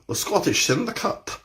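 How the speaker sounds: The Scottish Syndicate, rolled out in a playful hint of a Scottish accent; light Scottish accent. Shown as a demonstration. Instructor jokingly renames his viewers, in a light Scottish accent. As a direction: try The Scottish Syndicate with a touch of Scottish brogue. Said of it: "You can say it with a bit of a Scottish accent".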